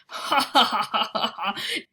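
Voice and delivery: loud laughing giant; the giant laughing at Sophie's disgust; roaring with laughter. Acted. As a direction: laugh big and talk through it. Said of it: "roaring with laughter".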